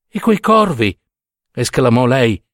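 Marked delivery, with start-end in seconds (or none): 0.1-1.0 high, horrified question; acted horror, pitched up; shudder of horror. A narrator's reading. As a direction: ask with a shiver of horror. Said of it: "con un brivido d'orrore".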